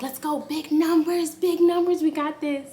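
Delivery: high, eager chant of big numbers; cheering. Retold or imitated. Mom cheering her daughter through contractions, eager and high.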